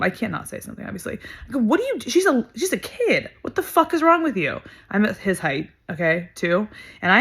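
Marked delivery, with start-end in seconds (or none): 1.6-4.6 high-pitched indignant outburst, retold; outraged protest. Bystander confronting the man who yelled at a kid, outraged. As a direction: voice jumping way up in disbelief. Acted confrontation defending the girl.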